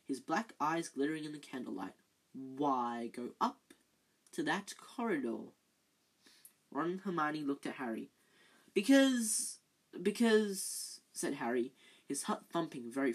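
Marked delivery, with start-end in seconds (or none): 2.3-5.5 slow Snape. Acted. Snape pressing. 8.7-11.0 flustered Harry. Acted.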